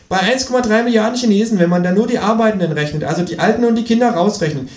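high, reckoning kangaroo voice. Acted. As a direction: calculate aloud in a high voice. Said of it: "sagt das Känguru".

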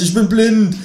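loud, high panicked cry; high-pitched alarmed shout; screaming. A narrator's reading. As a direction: yell it as if suddenly blinded. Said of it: "schrei ich".

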